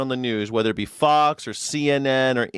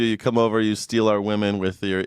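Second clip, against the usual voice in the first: normal talking voice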